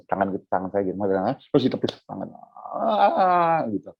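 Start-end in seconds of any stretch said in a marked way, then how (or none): 2.8-3.6 high mock cry of refusal; pitched-up mock wail; imitated child's whine. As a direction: whine like a kid pushing a hand away. Imitates child pushing hand away, wailing.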